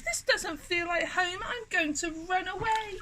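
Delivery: high, small unhappy voice; crying out. A narrator's reading. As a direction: lament as the left-out little dinosaur. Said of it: "And he cried".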